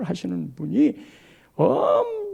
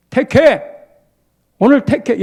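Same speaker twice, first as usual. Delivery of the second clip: commanding call; 3 semitones higher, 9 dB louder than usual. Retold or imitated. Joshua commands the people choose.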